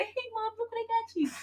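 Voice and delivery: put-on high, soft child presenting a gift; be a proud little girl; sweet daughter voice. Daughter showing mom a gift, high and sweet. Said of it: "she gonna be the one to say".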